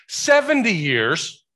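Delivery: seventy years slow, high and loud; emphatic slow; stress on seventy. Real, not acted. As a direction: stretch seventy out with force. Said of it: "not two years".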